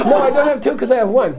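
high, quick mock objection; insistent protest. Friend argues back about her husband, mock protesting.

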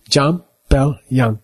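"Jam, Bell, Yang" word by word with pauses; separate syllables. Shown as a demonstration. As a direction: say "Jam", "Bell", "Yang" one at a time, sounding the l in Bell. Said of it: "if you say it fast".

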